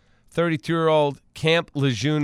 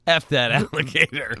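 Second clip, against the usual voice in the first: mock Bear quote. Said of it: "Bear said".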